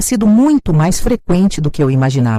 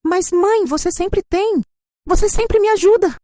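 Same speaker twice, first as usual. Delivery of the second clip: higher, whiny daughter begging her mother; pleading daughter; 12 semitones higher than usual. Acted. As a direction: plead like a grown child used to getting money.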